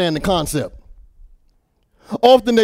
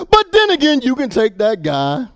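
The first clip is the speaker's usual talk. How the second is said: preacher pivoting to the congregation, high; preaching turn; 6 semitones higher than usual. Acted. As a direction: lift it high to turn the point.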